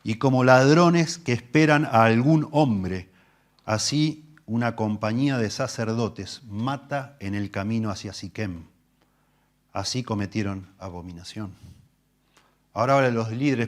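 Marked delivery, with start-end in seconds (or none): 0.0-11.5 slow, weighty Bible reading; solemn scripture reading. Acted. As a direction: read the psalm with gravity. Reading the prophet aloud.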